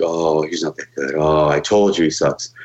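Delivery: mocking doubters. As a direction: mimic the crowd writing him off. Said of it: "everybody's like".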